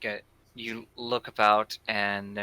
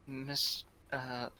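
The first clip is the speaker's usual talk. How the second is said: quiet nervous voice; 3 semitones higher, 9 dB quieter than usual. Acted. Felix voiced shyly.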